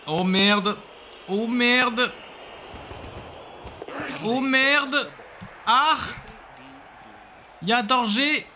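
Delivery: high, anxious cries; panicked. Real, not acted. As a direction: fret in rising alarm. Rising panic over play.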